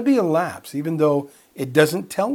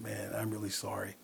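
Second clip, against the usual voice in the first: apology; 5 semitones lower, 16 dB quieter than usual. Retold or imitated. Apologising to former prisoner.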